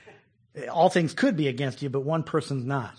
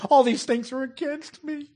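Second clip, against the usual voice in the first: mock lament; 9 semitones higher than usual. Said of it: "He says".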